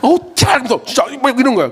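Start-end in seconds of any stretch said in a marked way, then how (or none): 0.0-0.5 high, loud mock curse of a sulky teenager; annoyed teen swearing. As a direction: spit out the curse like a fed-up teen. Teen girl swearing, imitated.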